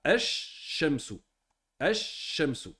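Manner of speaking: "Ash-Shamsu" with a long, marked double sh; exaggerated shadda. Shown as a demonstration. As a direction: overdo the held sh in "Ash-Shamsu". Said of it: "Bon, là, j'exagère un peu". (« Ash-Shamsu », shadda exagérée sur le ch)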